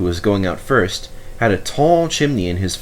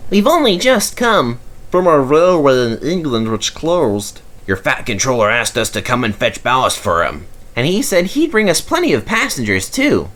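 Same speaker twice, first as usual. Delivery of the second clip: high, eager engine chorus; all answer together eagerly; 5 semitones higher than usual. A narrator's reading. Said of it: "all answered at once".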